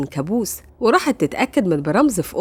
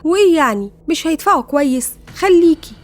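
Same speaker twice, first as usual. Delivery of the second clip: higher, louder dismissive questioning; lightly acted sister brushing it off, pitched up; amused sister; 7 semitones higher, 5 dB louder than usual. A narrator's reading. Sister urging Greta to keep the job, amused and dismissive. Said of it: "ضحكت وقالت لها".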